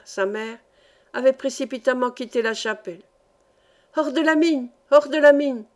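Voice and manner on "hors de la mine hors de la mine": higher, urgent warning call; narrator's shouted warning, building on the repeat; repeated alarm cry. A narrator's reading. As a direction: call everyone out, voice raised. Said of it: "répétait James Starr".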